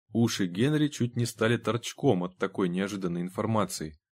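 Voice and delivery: usual speaking voice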